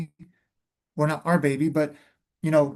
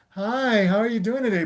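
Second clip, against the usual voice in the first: a lightly sugary, put-on hello; fake friendly greeting; 4 semitones higher than usual. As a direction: sound politely nice without meaning it. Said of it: "We would change our face and be like".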